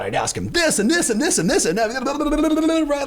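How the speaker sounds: high-pitched, tumbling list; gushing excitement. Retold or imitated. Imagining meeting Schwarzenegger, acted gushing excitement. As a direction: rattle off the list in a high, eager voice. Acts out eager imagined star encounter.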